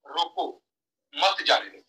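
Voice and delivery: रोको set apart, then मत जाने दो; pause after रोको. Shown as a demonstration. Speaker showing the first meaning, pause after रोको. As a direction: break the sentence right after रोको. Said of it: "sentence क्या आ गया?".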